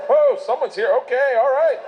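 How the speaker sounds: high, loud startled voice. Retold or imitated. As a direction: caught off guard, alarmed. Panicked reaction of people caught.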